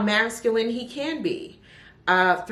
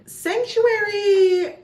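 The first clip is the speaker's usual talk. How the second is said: much higher, louder, slow and drawn out; dramatic title call; 6 dB louder, much slower than usual. Acted. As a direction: announce the title like a grand reveal. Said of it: "title sung out dramatically".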